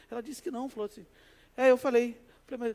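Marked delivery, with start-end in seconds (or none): none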